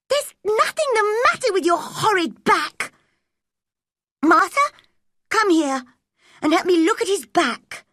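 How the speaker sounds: high, loud, bossy; cross command. A narrator's reading. Mary ordering Martha to check Colin's back. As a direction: order them about.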